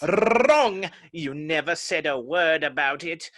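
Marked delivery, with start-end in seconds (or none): 0.0-0.9 triumphant contradiction. Acted. Humpty Dumpty contradicts Alice sharply.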